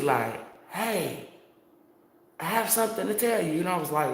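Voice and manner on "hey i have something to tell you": put-on high-pitched little British boy; light mock-British child's voice; a touch of British accent, pitched up. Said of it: "because every British accent".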